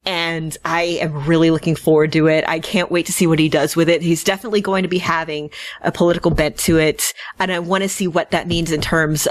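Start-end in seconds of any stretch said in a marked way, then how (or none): none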